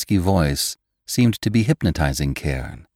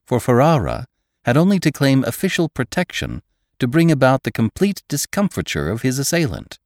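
usual speaking voice

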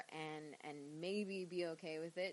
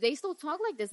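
loud, higher Queens accent; 8 semitones higher, 9 dB louder than usual. Shown as a demonstration. Wrestler imitating people back in Queens, Queens accent. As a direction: punch it out in a New York Queens accent. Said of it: "back where I still live in Queens".

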